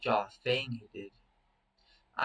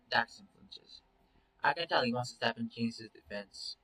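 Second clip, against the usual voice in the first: ordinary talk, nothing marked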